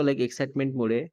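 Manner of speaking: ordinary delivery, nothing marked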